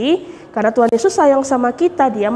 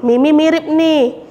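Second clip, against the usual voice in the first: ordinary delivery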